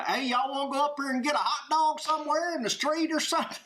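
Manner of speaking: high-pitched, hammed-up Southern holler; big Southern accent. Dad teasing his girls on crowded streets, biggest Southern accent. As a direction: exaggerate the Southern twang to embarrass the family. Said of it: "louder in the biggest Southern accent".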